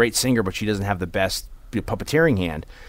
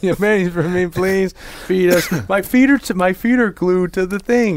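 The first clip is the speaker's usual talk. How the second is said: high, silly puppet voice, getting louder; goofing off; 9 semitones higher, 4 dB louder than usual. Retold or imitated. Imitating puppeteers between outtakes for the co-host, goofing off. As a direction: clown around in a high put-on voice. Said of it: "they're goofing off like".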